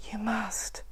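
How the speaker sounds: hushed whisper. Acted. The woman whispering back to furious Mac. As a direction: breathe it out softly. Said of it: "she whispered".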